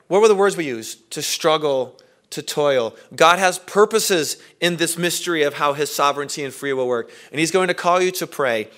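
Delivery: ordinary delivery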